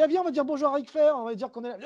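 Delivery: bounding up eager to meet his idol; eager greeting. Retold or imitated. To his colleague, spotting Ric Flair in the hotel, eager and fast. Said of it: "Je dis".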